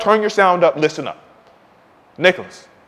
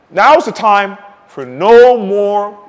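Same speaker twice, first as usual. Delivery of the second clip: drawn out; 5 dB louder, much slower than usual. Real, not acted. Emphatic declaration.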